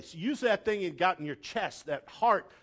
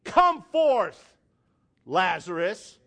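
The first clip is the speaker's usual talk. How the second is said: loud booming call at the trouble; shouted command; 7 dB louder, slower than usual. Pretend. Preacher shouting at the congregation's troubles, loud command. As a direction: shout it out like raising the dead. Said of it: "shout at 'em with a loud voice".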